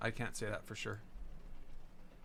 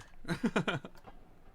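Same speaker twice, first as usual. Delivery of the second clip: laughing; 5 semitones higher than usual. Real, not acted. Laugh after moderator remark.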